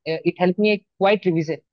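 ordinary delivery

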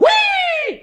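whoosh like a storm; a high wind howl hui. Shown as a demonstration. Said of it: "du musst jetzt immer hui machen".

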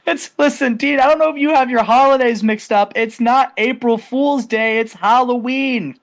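mock him with a smug grin; cocky Jericho. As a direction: talk down to him like a joke. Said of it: "He's like".

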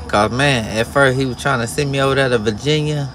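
usual speaking voice

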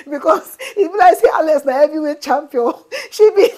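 bursting into loud laughter; laughing. Real, not acted. Vlogger laughing about Alex's punch, loud laugh. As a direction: laugh through the joke.

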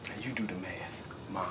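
low, whispered final jab; cold whisper. Acted. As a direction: hiss it coldly as a last blow. Son's cruel parting line.

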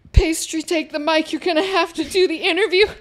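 high, strained, hammed-up dying plea; mock-heroic plea. Host on the floor hands co-caster the interview, mock-dramatic. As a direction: beg melodramatically, as if fallen in battle.

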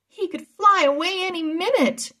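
high, loud call from the air above. Acted. Anna calling down from the dragon to the boys, loud and high. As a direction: shout down from the dragon's back so they hear. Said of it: "Anna called down from Capri".

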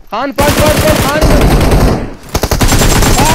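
loud, high hype yelling; excited shout. Real, not acted. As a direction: cheer him on at full volume. Egging on teammate in fight.

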